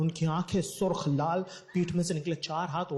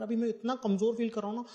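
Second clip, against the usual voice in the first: ordinary talk, nothing marked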